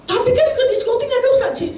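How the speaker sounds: high, playful protest "nggak dosa"; teasing protest. Retold or imitated. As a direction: voice the cheeky objector. Teasing objection from a listener. (protes bercanda dengan nada tinggi)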